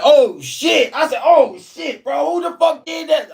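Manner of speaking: high, loud shocked outburst. Retold or imitated. Seeing his friend's beaten face, a high shocked outburst. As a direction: jump up in pitch at the sight.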